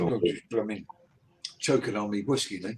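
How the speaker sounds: a low, soft mock Londoner; London accent. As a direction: mutter in a rough London accent. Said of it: "He's a Londoner".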